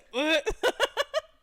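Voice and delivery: bright burst of laughter; loud high laugh. Real, not acted. Laughing at coincidence.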